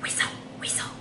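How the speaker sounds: hushed, whispered name-calling; playful whisper. Pretend. Reader teasing about the dirty weasel, whispering. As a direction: whisper it like a sneaky little echo.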